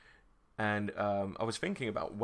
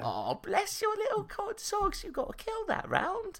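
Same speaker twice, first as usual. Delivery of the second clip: very high, condescending coo; mock babying kid. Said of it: "go straight onto the voice chat and be like".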